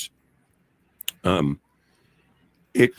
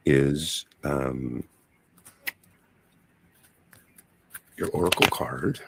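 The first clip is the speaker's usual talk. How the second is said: lower, hushed card reading voice; 10 semitones lower than usual. Acted. Tarot reader laying out cards.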